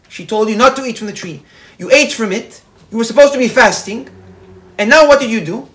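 acted scolding. Guard to the man who broke his fast, scolding.

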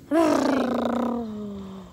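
long, drawn-out exhale; long sigh. Real, not acted. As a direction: let out a long, trailing sigh. Frustrated wait over a password.

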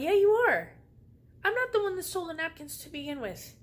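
high, loud indignant yell; angry retort. Retold or imitated. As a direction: snap back at him, outraged. Yelling back at the waiter.

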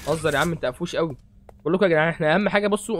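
ordinary delivery

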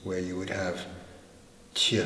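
ordinary talk, nothing marked

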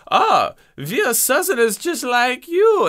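slow, high, hammed-up German accent. Time-traveling neo-Nazis posing as Southerners, in a German accent. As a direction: put on a thick German accent, drawn out. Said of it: "I just did German".

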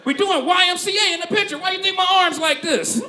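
high loud incredulous shout; dad exasperated. Retold or imitated. Dad explaining the Halloween photo to his son, high loud shout. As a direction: yell it like the answer is obvious. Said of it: "He said".

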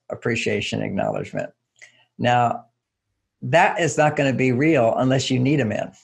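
ordinary delivery

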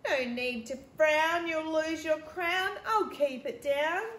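put-on girl teasing her sister playfully; cheeky. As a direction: tease sister with a rhyme. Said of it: "Beck cheekily said".

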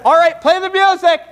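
high-pitched shouted royal order; calling out command. Acted. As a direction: call out to the musicians. King calls out to musicians.

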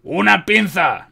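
loud, high, stretched-out call; drawn-out shouted demand; urgent order. A narrator's reading. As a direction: call urgently for a surgical tool. Medic demands tools during battle surgery.

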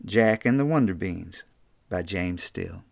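ordinary delivery, nothing marked

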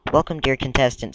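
ordinary talk, nothing marked

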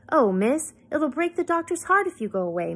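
high, plaintive housekeeper's lament; distressed, wailing appeal; miserable. A narrator's reading. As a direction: plead woefully, pitch lifted. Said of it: "sounding miserable".

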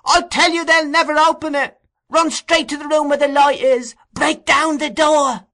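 very high, loud screamed directions; boy screaming. A narrator's reading. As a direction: scream to guide the rescuers in. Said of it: "screamed the boy".

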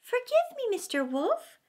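high, timid little plea; meek lamb. A narrator's reading. Lamb pleading with the hungry wolf, meek and high. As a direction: beg forgiveness in a small high voice. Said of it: "meekly answered the lamb".

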